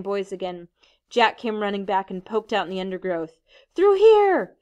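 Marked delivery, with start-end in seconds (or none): 3.7-4.6 loud, high, urgent call; urgent shout. Acted. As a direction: yell the way through. Boys chase boar, Jack calls.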